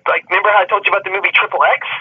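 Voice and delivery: ordinary talk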